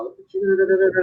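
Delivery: ordinary talk, nothing marked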